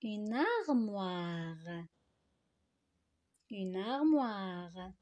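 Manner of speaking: very slow, vowels stretched in "armoire"; drawn-out model reading. Shown as a demonstration. Teacher reads the completed noun for pupils, very slowly drawn out. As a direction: stretch out "Une armoire" for learners. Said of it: "les compléter par les syllabes".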